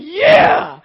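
high, loud whoop; shouting with joy; cheering yell. Retold or imitated. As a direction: let out a victory cry. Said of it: "I'm like".

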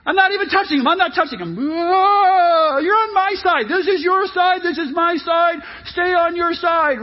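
high, hammed-up backseat whining; bickering kid. Kids in back of the car fighting over sides, high whining. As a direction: squabble like a child over territory. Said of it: "if you're a kid".

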